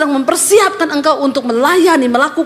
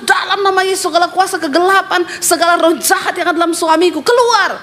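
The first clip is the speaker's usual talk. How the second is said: raised-pitch commanding prayer; insistent deliverance prayer; 4 semitones higher than usual. Retold or imitated. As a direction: cast out the spirits, climbing on the last word. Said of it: "Dia doa terus". (doa pengusiran yang mendesak, nada naik)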